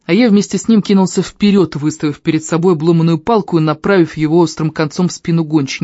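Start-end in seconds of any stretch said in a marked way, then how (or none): none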